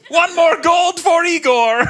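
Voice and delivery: put-on loud, slow, high Russian accent shout; Russian announcer. Russians cheering their dog's win, loud Russian accent. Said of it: "first event starts".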